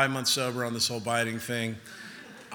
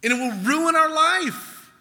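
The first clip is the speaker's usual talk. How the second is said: loud, high, driving sermon voice; fiery preaching; 11 semitones higher, 7 dB louder than usual. Acted. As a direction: preach it hard to the crowd. Preaching at full force.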